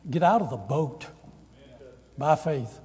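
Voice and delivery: loud preaching call; preached loudly. Real, not acted. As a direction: proclaim it loudly to the congregation. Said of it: "sermon refrain, loud take".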